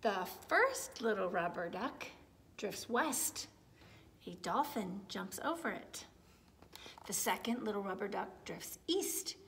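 gentle storybook narration, lots of tune; reading to children. A narrator's reading. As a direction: read it like bedtime to little ones. Reading a picture book aloud.

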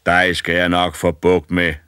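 loom over him and promise death; menacing old giant. A narrator's reading. Old man.

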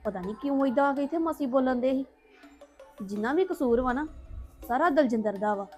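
soft, sulky muttering to herself; quiet grumbling. Acted. Daughter muttering to herself after the family fight, quiet and sulky. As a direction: grumble under your breath, alone and resentful.

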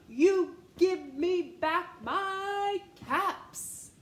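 very slow, loud shouted demand; furious peddler shouting for his caps. Acted. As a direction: stamp each word out. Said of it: "He stamped his foot and he said".

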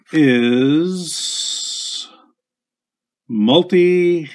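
put-on high, showy Italian accent on multistrato. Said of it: "How's that for my Italian accent?".

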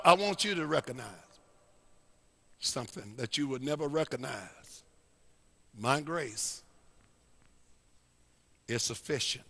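soft, halting divine reply; hushed, measured voice of God; Lord's quiet answer. Acted. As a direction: answer softly, with long pauses between phrases. Voicing the Lord's answer to Paul.

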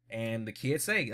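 usual speaking voice

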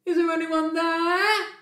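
loud, high, sing-song query; cautious calling out. Acted. Bear checks before scratching, cautious high call. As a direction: call out to check nobody is about. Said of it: "asked Bear cautiously".

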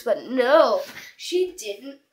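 ordinary talk

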